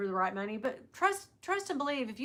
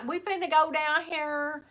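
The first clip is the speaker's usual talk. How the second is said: country drawl; 2 semitones higher, slower than usual. Retold or imitated. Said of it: "most country person around".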